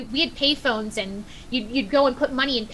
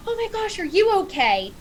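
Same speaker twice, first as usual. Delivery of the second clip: worried cry; 8 semitones higher than usual. Retold or imitated. Worried call to injured dog.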